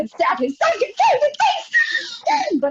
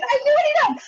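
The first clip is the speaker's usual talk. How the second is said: much higher, peaking cry; shouting; 11 semitones higher than usual. Retold or imitated. Woman yelling at the waiter in a crowded diner, much higher. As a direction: let it crack at the top. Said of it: "I shouted!".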